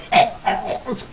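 choked dying splutter; acted choking cough. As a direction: cough it out like a struck victim. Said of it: "and she's like".

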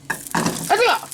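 gasping with a mouthful of hot food; breathy yelp through a hot bite; mouth full of hot food. Real, not acted.

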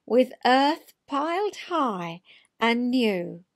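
lilting read-aloud of a rhyming poem; storyteller reading a funny poem. A narrator's reading. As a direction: tell it like a children's verse tale.

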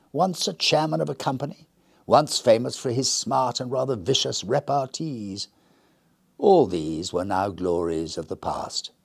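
usual speaking voice

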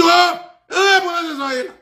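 very high, loud mock shriek of outrage; shocked outburst. Student scandalised by the speaker's answer, shocked. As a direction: squeal like a scandalised man, exaggerated. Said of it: "il m'a choqué".

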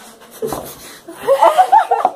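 very high laughing shriek. Real, not acted. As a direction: squeal with laughter and pain. Pepper burning, high shriek. (risada aguda de dor)

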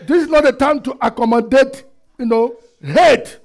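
energetic preacher coming down from a shout; forceful preaching. Real, not acted. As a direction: admonish the congregation firmly from the pulpit. Preaching on after the climax.

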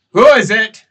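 shouted reply. Acted. Cultist shouting back from the camp, loud. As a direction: bark back at the newcomers.

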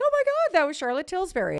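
high, loud fangirl squeal easing off; squealed disbelief, settling slightly; starstruck excitement. Retold or imitated. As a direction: reenact being thrilled by a celebrity sighting. Acting out starstruck reaction.

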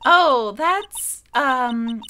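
put-on man doing a ditzy valley girl. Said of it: "Like, Valley Girl's good for this".

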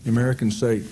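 normal talking voice

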